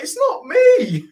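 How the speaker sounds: high, drawn-out squealed denial; laughing, stretched-out wail of innocence; indignant protest. Retold or imitated. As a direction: retell it as a loud, high-pitched protest. Protesting when blamed, retold.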